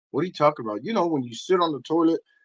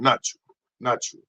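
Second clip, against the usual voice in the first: ordinary talk, nothing marked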